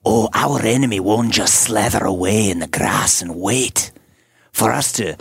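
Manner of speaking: slow, lightly done Scottish battle speech; a bald, bearded Scottish swordsman rallying teenagers, slow; light Scottish accent, rallying the troops; Scottish warrior accent. Acted. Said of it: "We need to hear the Scottish accent".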